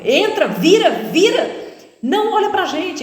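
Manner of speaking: high, loud frantic commands; robber yelling orders. Retold or imitated. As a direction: bark orders at the victim. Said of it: "eles têm esse negócio". (gritando ordens, agudo e alto)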